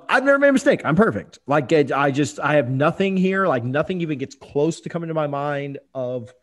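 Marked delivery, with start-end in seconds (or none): 0.1-1.4 mock arrogant boast; sarcastic boast. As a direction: brag with tongue in cheek. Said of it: "My humility also shines through".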